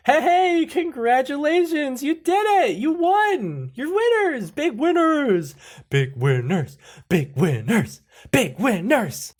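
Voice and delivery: very high, loud whooping congratulations; celebratory announcer. Acted. Phone voice congratulates the winners, wildly celebratory. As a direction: shout it like a manic prize announcer.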